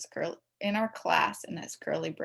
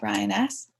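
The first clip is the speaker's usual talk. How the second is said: ordinary delivery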